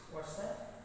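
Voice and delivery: normal talking voice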